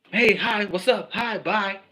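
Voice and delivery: ordinary talk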